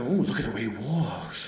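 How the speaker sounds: soft, low doting coo over a cat; mock cooing owner. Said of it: "we go".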